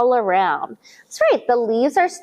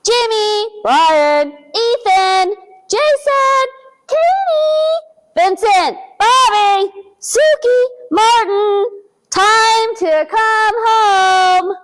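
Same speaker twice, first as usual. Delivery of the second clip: put-on mom calling kids home; 8 semitones higher, 4 dB louder, slower than usual. A narrator's reading.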